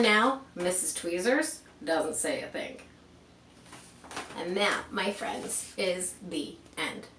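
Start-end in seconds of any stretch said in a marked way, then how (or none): 4.4-7.1 slow, cozy closing line; story ending. A narrator's reading. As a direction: wind the story down slowly. Closing a read-aloud story.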